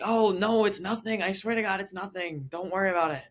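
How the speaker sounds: high-pitched insistent denial; mock-frantic high reassurance; hurried dismissal. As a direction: brush it off in a high, overeager voice. Retold frantic reassurance to parents.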